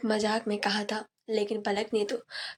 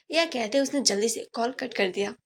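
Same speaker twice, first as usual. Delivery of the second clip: plain reading voice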